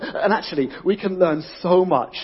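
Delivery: usual speaking voice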